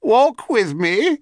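high, slow crone voice; old hag voice. A narrator's reading. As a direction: lean into the creaky hag. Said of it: "said the hag".

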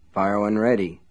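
plain reading voice

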